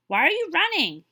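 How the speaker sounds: loud, high shout. Acted. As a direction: shout after the running hares. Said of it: "he shouted".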